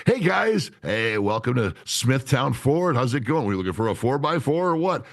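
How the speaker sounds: high, overdone dealership greeting; fake salesman voice. Shown as a demonstration. Car salesman greeting customers at Smithtown Ford, fake salesman voice. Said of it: "Like, just knock that shit off".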